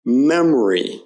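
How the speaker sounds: "memory" as MEM-uh-ree, middle O weakened to uh; schwa in memory. Shown as a demonstration. Teacher modelling a word for students, "memory" with a weak middle uh. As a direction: stress MEM, swallow the O in "memory". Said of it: "unstressed syllables in words like".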